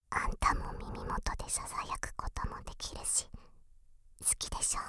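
soft unvoiced whisper at the ear; whispering in your ear.

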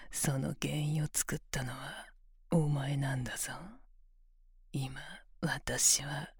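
close-mic ASMR whisper, commanding; whispered domination. Acted. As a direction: tease him in a cool, controlled whisper.

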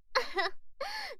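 high bright giggle; a quick amused giggle. Acted. Greeting a customer, giggling.

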